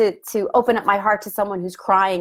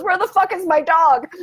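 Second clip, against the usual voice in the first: much higher, louder, frantic; retold panic; 9 semitones higher, 4 dB louder than usual. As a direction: blurt it out in alarm. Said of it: "My first thought was".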